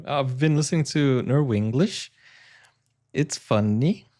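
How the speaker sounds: lilting Norwegian-English, ends swinging up; playful Norwenglish sing-song; Norwenglish accent. Shown as a demonstration. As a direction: imitate Norwegian-accented English with rising endings. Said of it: "imitating the Norwe-, Norwenglish that I'm listening to".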